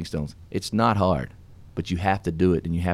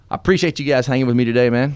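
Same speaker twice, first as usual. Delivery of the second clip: sign-off; 5 semitones higher, 6 dB louder than usual. Acted. Radio show sign-off.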